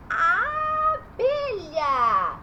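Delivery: "abelha" stretched with a wide open "a"; loud, very slow "abelha", syllable by syllable; open A sound. Shown as a demonstration. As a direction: draw out the open first vowel of "abelha". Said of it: "eu falo A".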